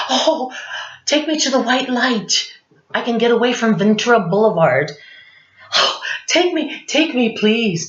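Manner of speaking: high, theatrical begging; hammed-up plea to be taken; mock pleading. Begging death to take her.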